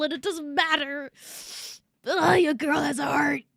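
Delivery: real tears breaking through; crying. Real, not acted. Streamer admits to chat she has a heart, crying. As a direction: voice catching, holding back sobs. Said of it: "making me cry".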